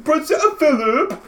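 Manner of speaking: high, slow mock British exclamation; hammed-up British accent. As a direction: cry the name out like a pompous Brit. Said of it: "I can't do accents".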